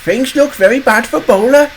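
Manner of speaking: high, amused character voice; tickled little engine commenting; chuckling Rheneas. A narrator's reading. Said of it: "chuckled Rheneas".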